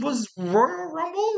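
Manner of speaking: ROYAL RUMBLE drawn out, climbing high in doubt; unsure, rising question. Real, not acted. Host recalling the wrestler's last match, "Royal Rumble" rising unsurely.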